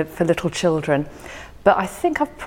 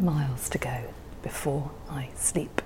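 the repeated last line whispered, much softer; whispered close; 3 semitones lower, 10 dB quieter than usual. Acted. Closing the recited poem for the host, whispered. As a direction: let the final line fade into a whisper. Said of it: "repeated closing line whispered".

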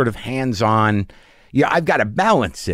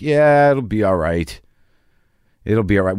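exasperated; 2 semitones lower than usual. Retold or imitated. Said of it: "With just that exasperated face".

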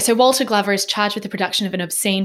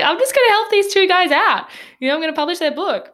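high, fast, breezy helper voice; light Walter impression; 9 semitones higher than usual. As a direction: play Walter as eager and chirpy.

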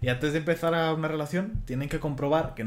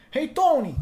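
high, loud New York Italian-American caricature; big, loud Italian-American New Yorker accent; 14 semitones higher, 6 dB louder, slower than usual. Acted. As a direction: do Joey Tribbiani's New York accent, pitched way up. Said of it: "el acento de".

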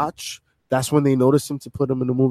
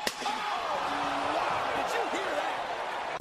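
long, high, soft wheezing laugh; helpless laughter, nearly out of air; 11 semitones higher, 15 dB quieter than usual. Real, not acted. Panelist cracks up over the entrance botch, laughing.

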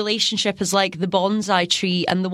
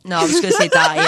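laughing; 2 semitones lower, 5 dB louder than usual. Real, not acted. Joking about men, laughs.

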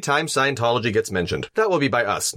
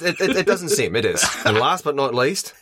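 normal talking voice

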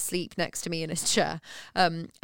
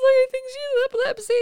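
very high, loud mock-tearful wail; imitating herself crying; 5 dB louder than usual. New mother to midwife about her baby, mock-tearful squeal. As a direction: squeak it out as if in tears. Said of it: "you started crying".

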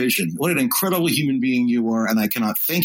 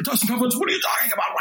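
higher, fast, frantic shout; panicked victim; 10 semitones higher, faster than usual. Retold or imitated. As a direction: yell like a man in the dentist's chair. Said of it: "And he's like".

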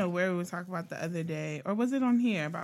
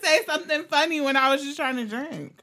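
higher, fast, defensive; high defensive protest; 10 semitones higher, 4 dB louder than usual. Real, not acted. Defends herself after spitting.

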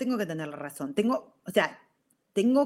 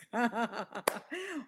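real laugh at herself; risa; 2 semitones higher, 6 dB quieter than usual. Real, not acted. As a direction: laugh freely at your own craziness. Laughs at her own confession. (risa espontánea de sí misma)

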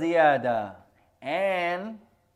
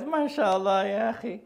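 mock amused wife; 7 semitones higher than usual. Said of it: "and she says".

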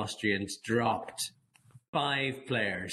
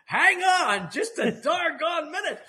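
high, loud cry of defiance, slowing into a laugh; mock-heroic stand, laughing at the end; defiant footballer; 7 dB louder, slower than usual. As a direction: throw up a hammy cowboy protest. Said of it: "Jimmy Nicholl goes".

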